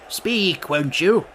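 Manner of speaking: king's cry. A narrator's reading. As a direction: call out a demand as the king. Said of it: "cried the king".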